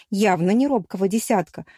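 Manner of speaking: ordinary delivery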